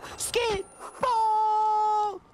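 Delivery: high, drawn-out shriek; startled shriek. Real, not acted. Streamer spotting the enemy suddenly, high shriek. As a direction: shriek it out in shock.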